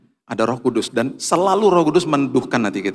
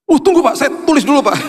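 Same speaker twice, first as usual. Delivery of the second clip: high, loud, eager reply; eager driver; 13 semitones higher, 4 dB louder, faster than usual. Retold or imitated. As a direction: jump in keen to write it down. Driver eagerly notes the name.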